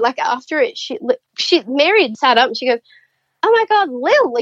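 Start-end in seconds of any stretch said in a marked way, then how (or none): 3.4-4.4 high-pitched imitated surprise; oh-it's-her exclamation, retold; retold sudden recognition. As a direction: act out her realisation, pitched well up. Mary suddenly recognises who Lil is.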